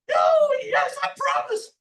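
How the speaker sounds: very high, loud squealed surrender; desperate little demon. A narrator's reading. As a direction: wail it in a tiny devil voice. Trapped little demon gives in.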